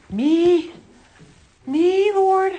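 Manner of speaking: incredulous, high, loud "me, Lord?"; astonished disbelief. Retold or imitated. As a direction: gasp it out like you can't believe he means you. Voicing an unworthy believer, amazed.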